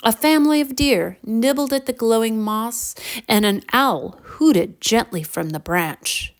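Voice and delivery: ordinary delivery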